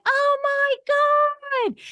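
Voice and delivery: very high, slow, drawn-out oh my God; amazed squeal. Retold or imitated. Seeing a longtime online member in person, squealing with joy. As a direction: squeal with delight at seeing her.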